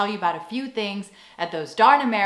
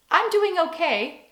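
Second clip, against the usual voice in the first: higher, slower, would-be casual admission; supposedly normal; 8 semitones higher than usual. Shown as a demonstration. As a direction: try to sound normal while stretching it out. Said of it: "supposed to sound really normal".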